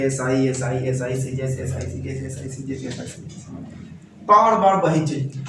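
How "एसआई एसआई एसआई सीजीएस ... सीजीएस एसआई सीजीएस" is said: low drone fading away to a whisper; mock a droning lesson, trailing into near silence; monotonous repetition. Teacher mimicking the same lesson over and over, fading drone. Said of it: "बार बार वही चीज पढ़ा रहे हैं".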